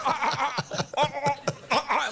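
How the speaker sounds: stifled high gagging noises; silent retching gags. Retold or imitated. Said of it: "try to vomit silently".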